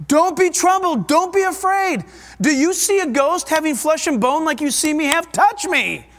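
high, insistent reassurance; Jesus. Acted. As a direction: urge frightened friends to believe you, acted with force. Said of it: "he's there and he's saying".

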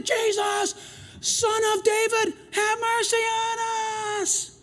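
high, loud cry for mercy, pleading; desperate yelling. Retold or imitated. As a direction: call out over a crowd, begging. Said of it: "they yelled more and loud".

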